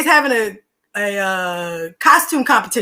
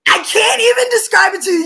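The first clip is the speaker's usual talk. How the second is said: high, loud exclaim; louder and higher in disbelief; excited shout; 10 semitones higher, 4 dB louder than usual. Real, not acted. Overwhelmed retelling.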